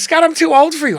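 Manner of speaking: loud, high mimicked protest; high-pitched imitated objection; Joan Rivers. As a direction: call it out brashly as the comedian. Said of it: "this unmistakable voice".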